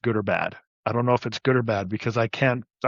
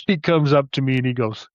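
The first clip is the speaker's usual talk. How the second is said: usual speaking voice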